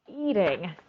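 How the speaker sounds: usual speaking voice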